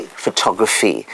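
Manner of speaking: "photography" stressed on the second syllable; shifting word stress. Shown as a demonstration. Speaker shows stress shift, "photography" stressed on TOG. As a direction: say "photography" as pho-TOG-raphy. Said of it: "the stress could shift".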